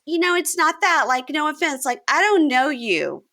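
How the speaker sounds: prissy explanation, hammed up; high mock Taylor. Taylor to Tanya, high prissy complaint. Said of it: "And Taylor goes".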